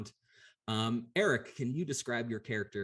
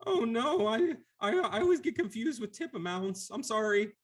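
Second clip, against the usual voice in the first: timid Lemure; 13 semitones higher, 5 dB louder than usual. Acted. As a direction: squeak it out like a weepy blob creature. GM voices Lemure.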